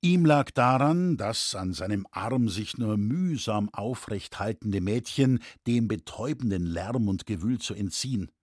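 ordinary delivery, nothing marked

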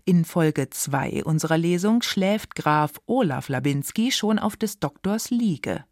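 usual speaking voice

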